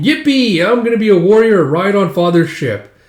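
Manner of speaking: high, loud gleeful mimicry; mock childish excitement. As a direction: mimic an overexcited little boy. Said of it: "You're thinking".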